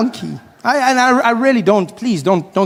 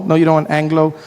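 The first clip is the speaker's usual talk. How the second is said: normal talking voice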